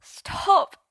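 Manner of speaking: a high, loud, cracking plea; begging, nearly crying. A narrator's reading. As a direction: cry it out as he's about to break into tears. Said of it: "Merlin begged, nearly crying".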